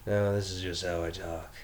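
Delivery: Batman voice. Acted. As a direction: growl it out like a brooding Batman. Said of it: "Just go right into the Will Arnett".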